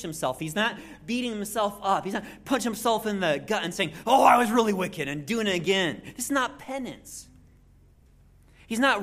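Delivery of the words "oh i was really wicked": a loud, hammed-up cry of guilt; mock wail. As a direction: shout it like overdone penance. Mock self-punishment, shouted.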